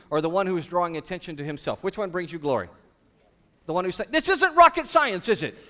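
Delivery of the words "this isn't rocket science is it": high playful voice. Retold or imitated. Child's reply in parent scenario.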